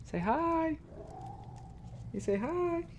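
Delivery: very high, soft coaxing. Real, not acted. Coaxing baby to say hi.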